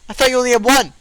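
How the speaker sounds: high loud squeaky elf; indignant little elf. Acted.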